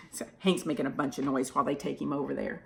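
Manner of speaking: plain reading voice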